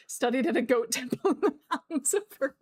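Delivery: words shaken by real laughter; laughing. Real, not acted. Streamer jokes about goat training, laughing. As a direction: giggle through the sentence.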